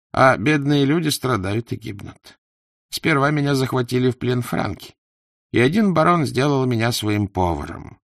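animated storyteller of his own misfortunes; plaintive, lively character voice with swooping stresses; lamenting poor trader. Acted.

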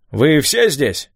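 high, drawn-out query; calling question. A narrator's reading. Mayor checks that all the boys arrived, calling out. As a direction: slowly call out to the whole group.

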